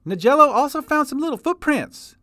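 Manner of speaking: put-on high, loud folksy old-timer voice; old prospector. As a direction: holler it like a grizzled prospector. Chester speaks.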